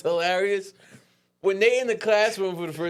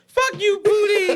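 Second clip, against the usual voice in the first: high, loud mock outburst; laughing high-pitched quoted yell; movie quote shout; 5 dB louder, slower than usual. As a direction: holler it like a favourite film line. Quoting a favourite comedy film.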